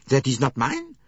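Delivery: loud, high, rising protest of innocence; the maid frantically denies hiding the uniform; pleading denial. A narrator's reading. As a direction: insist desperately you did not do it. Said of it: "looked from one to another pleadingly".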